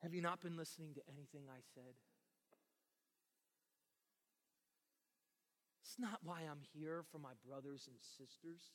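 low, soft, held-back reproach; acted weary patience; quiet exasperation. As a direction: answer the interruption quietly and low. Jesus wearily answers an interruption.

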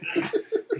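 airy giggle after the voice; high breathy laugh. Real, not acted. Laughs at own grandma voice.

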